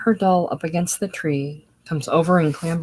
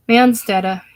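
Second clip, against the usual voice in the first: put-on higher, loud childish call; little girl calling; 5 semitones higher, 9 dB louder than usual. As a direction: point and call out like a toddler. Child points and calls out.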